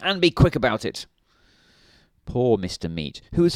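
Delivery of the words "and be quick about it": snapped. A narrator's reading. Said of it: "snapped Mister Grumpy".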